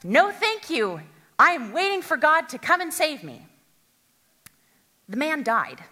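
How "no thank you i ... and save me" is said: higher, louder stubborn yell; yelling back. Acted. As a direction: shout your refusal up at them. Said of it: "And the man yelled back".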